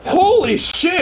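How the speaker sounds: high, loud surprise; surprised. Real, not acted. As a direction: blurt out in shock. Finds the tapes.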